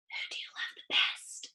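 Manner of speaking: soft secret whisper. Acted. Boy asking his mother a secret question, whispered. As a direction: cup your hands and whisper. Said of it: "Julian whispered".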